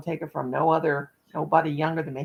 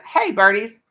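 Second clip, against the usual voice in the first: louder, sing-song call to the pet birds; 8 dB louder than usual. Real, not acted. Talking to her pet birds, loud coaxing call. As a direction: coax the birdies from the cage.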